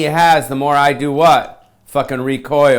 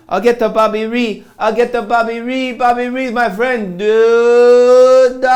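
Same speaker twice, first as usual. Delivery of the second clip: much higher, put-on voice ending loud; mock accent voice; 7 semitones higher, 3 dB louder than usual. Comic mocking Bobby Lee's name, silly high voice. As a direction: bounce it up into a silly voice.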